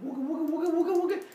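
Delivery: high chanted wooga; high sing-song flying sound; whooshing noise. Retold or imitated. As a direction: mimic the spear whirling.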